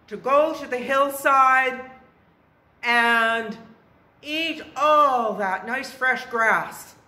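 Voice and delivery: loud, bold goat declaration; biggest billy goat. A narrator's reading. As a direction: state your plan forcefully, phrase by phrase.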